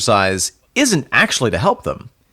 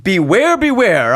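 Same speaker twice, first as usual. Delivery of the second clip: spooky sign-off; 12 semitones higher, 3 dB louder, slower than usual. Acted.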